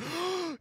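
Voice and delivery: high sharp imitated gasp; mock crowd gasp. Crowd hearing Cody's announcement, mock gasp. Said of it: "you know, gasp".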